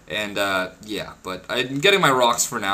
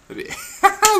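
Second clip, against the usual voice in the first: short squeaky laugh of glee; real delighted giggle; high giggle. Real, not acted. Gamble going his way.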